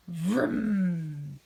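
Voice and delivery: loud engine vroom; loud rocket vroom sound. Acted. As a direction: roar like a rocket. Rocket blasts off.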